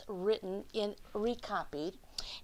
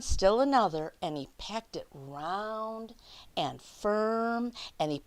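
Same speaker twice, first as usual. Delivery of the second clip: lively picture-book read-aloud; reading to children; 4 dB louder than usual. Acted. Teacher reading The Snowy Day to her class, lively read-aloud. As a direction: read it out like story time for little kids.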